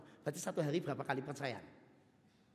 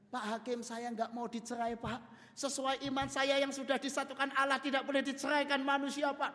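earnest wife; 12 semitones higher, 6 dB louder than usual. Acted. Wife answers the judge.

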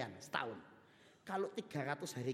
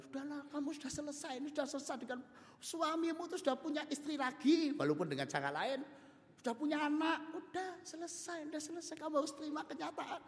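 high, pressing voice of meddling friends; persuading friends; 13 semitones higher than usual. Acted. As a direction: urge her higher to give up. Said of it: "Istrinya disuruh teman-temannya".

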